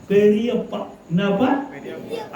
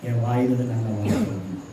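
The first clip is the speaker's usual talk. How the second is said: prayer; 6 semitones lower, 6 dB quieter than usual. Shown as a demonstration. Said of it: "ஆண்டவருக்கு ஒப்பு கொடுங்க".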